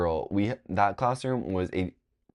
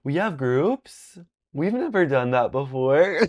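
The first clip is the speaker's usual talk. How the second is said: higher, louder and slow in mock disbelief; hammed-up confused student; mock surprised question; 7 semitones higher, 4 dB louder than usual. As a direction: act stunned that there are groups. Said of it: "I was like".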